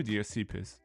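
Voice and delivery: usual speaking voice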